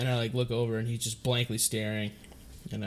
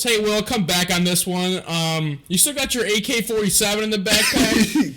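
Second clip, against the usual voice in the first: raised, loud imitation of a buddy; loud walkie-talkie prank; 9 semitones higher, 9 dB louder than usual. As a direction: blare it out so everyone nearby hears. Imitating buddy over walkie app.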